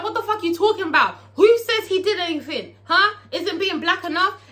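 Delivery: high, loud, fast angry comeback; heated retort. Retold or imitated. Big Teak snapping back at Rome in the argument. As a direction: fire it right back at him. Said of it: "And Big T's like".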